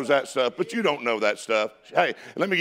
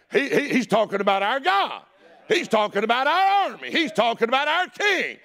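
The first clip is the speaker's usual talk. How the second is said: rising higher with each phrase; indignant outcry; 8 semitones higher than usual. Acted. Preacher as David hearing God and king defied, mounting indignation. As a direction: build the outrage, pitch climbing to the last word.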